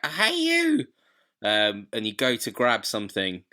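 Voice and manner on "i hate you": mock shriek. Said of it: "you're like".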